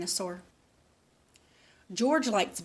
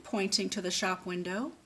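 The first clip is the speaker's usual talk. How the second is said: usual speaking voice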